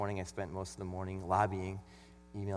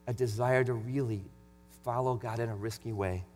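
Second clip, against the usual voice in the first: raised, sermon-style Bible reading; louder preaching read of John 9; scripture reading; 5 semitones higher, 7 dB louder than usual. Acted. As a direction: read the passage out like from the pulpit.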